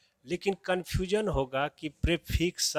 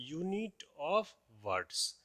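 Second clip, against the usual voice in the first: "Unit of words" drawn out very slowly, each word stressed; slow emphatic repeat; 4 dB quieter, much slower than usual. Shown as a demonstration. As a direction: spell it out slowly so students remember. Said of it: "क्या कहलाएगा?". (बहुत धीरे, हर शब्द पर ज़ोर देकर)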